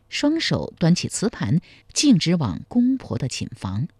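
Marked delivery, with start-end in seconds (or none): none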